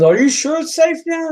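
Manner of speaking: high-pitched, wary question; nervous check. Acted. Customer checking before climbing back on, nervous. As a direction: ask it warily, needing reassurance.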